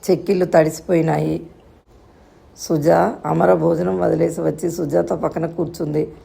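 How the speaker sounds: ordinary delivery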